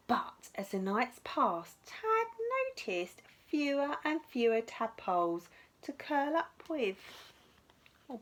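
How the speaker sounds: ordinary delivery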